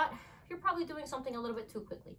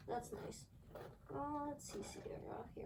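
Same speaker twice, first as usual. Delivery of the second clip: whispered aside; whispering; 3 semitones higher, 11 dB quieter than usual. Real, not acted. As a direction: hush while the dogs wait. Whispering aside near dog.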